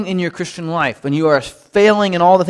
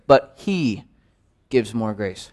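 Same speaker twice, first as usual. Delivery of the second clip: "But he", a held pause, then a soft stressed "gives"; low, meditative read with a pause before "gives"; 7 semitones lower, 5 dB quieter, slower than usual. Shown as a demonstration. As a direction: hold the breath after "he", then lean on "gives". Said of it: "each time emphasize a different word".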